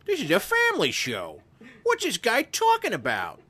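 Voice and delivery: indignant librarian. Acted. Mock librarian complaining about the family show, indignant. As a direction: put on a scandalized, high-pitched complainer. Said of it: "some librarian in, like, Hyannisport is like".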